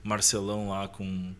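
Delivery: ordinary delivery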